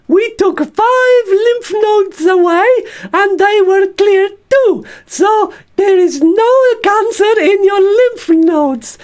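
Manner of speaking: very high, slow squeaky puppet voice playing the surgeon; Bert the stoma bag as the surgeon. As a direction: squeak it out like a little puppet doctor. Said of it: "can you be the surgeon for me".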